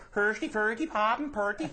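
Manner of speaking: high sing-song mock Swedish gibberish; a Swede in Sweden, hammed-up Swedish accent. Immigrant back in Sweden chatters in mock Swedish. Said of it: "He's in Sweden going".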